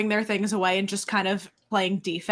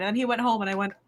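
ordinary talk, nothing marked

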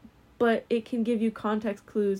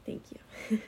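whispered thanks under the breath; 8 dB quieter than usual. Real, not acted. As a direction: breathe the thanks, barely voiced.

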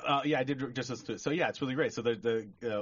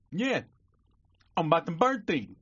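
high, louder mangled request; garbled-speech customer; 6 semitones higher, 4 dB louder than usual. Acted. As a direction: mush the words in a raised voice. Same customer returns in joke.

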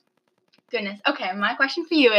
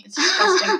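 high squeaky disgust; 10 semitones higher than usual. Acted. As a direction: jump way up, mock disgusted. Repeats in higher mock voice.

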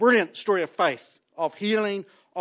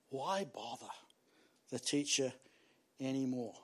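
hushed bad news; 6 semitones lower, 12 dB quieter than usual. Messengers' line voiced in story.

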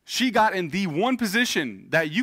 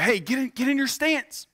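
put-on high-pitched urgent call to a child; sideline coaching call; 10 semitones higher than usual. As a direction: call out to your daughter in goal. Dad calling to daughter in goal.

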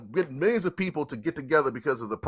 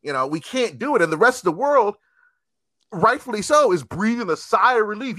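normal talking voice

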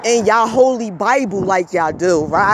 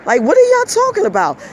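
pitched way up in disbelief; incredulous; 11 semitones higher, faster than usual. Real, not acted. Scolding viewers over the virus, high incredulous question. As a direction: ask it like you can't believe them.

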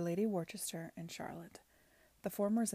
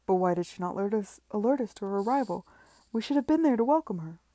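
louder and fast, words tumbling out; flustered alarm; 8 dB louder, faster than usual. A narrator's reading. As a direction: rush it in a panic to get there. Tom flustered at missed arrival.

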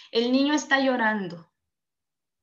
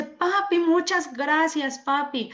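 put-on high, slow childlike thanks; a small boy gushing thanks to his dad; little boy's delighted thank-you, pitched up; grateful child; 4 semitones higher, slower than usual. Happy boy hugging and thanking father.

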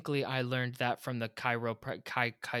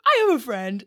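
eager; 11 semitones higher, 12 dB louder than usual. Retold or imitated. Mock eager quote to spammers.

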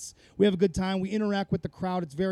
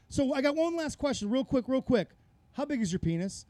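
higher, casual set-up then the punchline; bait question; 6 semitones higher than usual. Retold or imitated. Prank caller baiting a show host, casual then blunt. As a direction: play it friendly before the zinger. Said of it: "you just go".

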